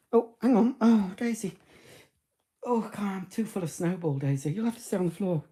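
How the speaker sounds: high, cooing pet talk; talking to the dog. Real, not acted. Fussing over dog Daisy.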